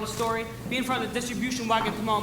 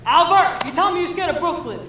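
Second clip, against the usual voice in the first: exasperated, high-pitched shout; loud challenge; 6 semitones higher, 8 dB louder than usual. Acted. Strike leader pushing scared newsies to take Brooklyn, challenging. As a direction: goad the reluctant gang loudly.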